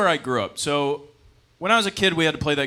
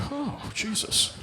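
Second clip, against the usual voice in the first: appalled groan; 12 semitones higher, 4 dB quieter, much slower than usual. Retold or imitated. Viewer reacting in disgust.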